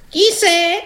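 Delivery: very high-pitched granny voice; grandmother calling out. Acted. Grandmother answering a knock, high calling voice. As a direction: call out high from indoors.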